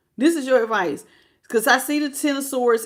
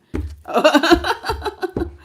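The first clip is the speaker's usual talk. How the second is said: laughing; 7 semitones higher, 4 dB louder than usual. Real, not acted. Laughing at the misunderstanding.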